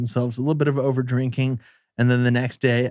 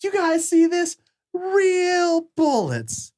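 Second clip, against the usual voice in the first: high, drawn-out shocked voice rising higher; mock alarm; much slower than usual. Actor showing the guys the real bullets, high stretched alarm.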